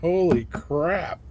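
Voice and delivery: real amazement, stretched out; amazed exclamation. Real, not acted. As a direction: savor the surprise. No waiting to leave orbit.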